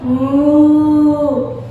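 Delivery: one long imitated moo; cow mooing. Shown as a demonstration. Teacher repeating the sound clue, long cow moo. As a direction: moo like a cow on a held note. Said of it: "it sounds like this".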